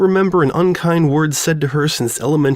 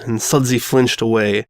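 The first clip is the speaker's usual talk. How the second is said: plain reading voice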